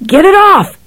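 high panicked scream; terrified scream. A narrator's reading. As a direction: scream in terror. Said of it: "he screamed".

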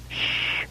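drawn "shh", unvoiced; repeated shush. A narrator's reading. Someone shushing Ben mid-sentence, hissed shush. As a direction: cut in with a hiss for silence. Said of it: "someone said again".

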